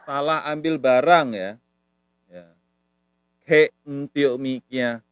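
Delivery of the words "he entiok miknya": slow, louder teaching model; drawn-out language demo; model phrase. Shown as a demonstration. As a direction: say each word slowly for learners. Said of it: "ambil itu the".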